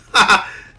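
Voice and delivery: loud, high burst of laughter; short, loud cackle of amusement. Real, not acted. Got a kill in game.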